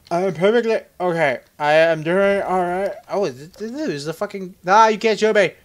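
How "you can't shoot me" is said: ordinary talk, nothing marked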